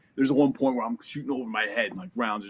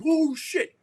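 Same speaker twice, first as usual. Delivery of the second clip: much higher, louder burst of alarm; startled curse; 6 dB louder than usual. Retold or imitated. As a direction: jolt out of it as rounds fly. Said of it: "I'm like".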